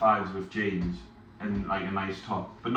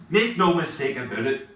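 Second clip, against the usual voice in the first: mock Northern Irish accent; 5 semitones higher, 4 dB louder than usual. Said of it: "That was Northern Irish".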